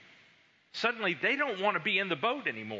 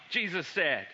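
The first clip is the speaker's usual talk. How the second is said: ordinary delivery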